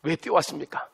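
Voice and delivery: ordinary delivery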